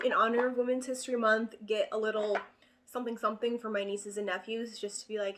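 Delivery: usual speaking voice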